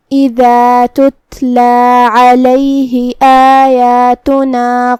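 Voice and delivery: slow, drawn-out tajweed chanting; Quran recitation. Acted. Teacher recites the verse for students, slow chant. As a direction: recite each verse slowly and melodically.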